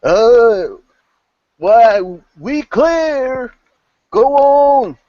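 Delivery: very high, loud, long-held holler; drawn-out lookout's shout; distant voice calling down. Acted. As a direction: call down from far above. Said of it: "you hear a voice come down".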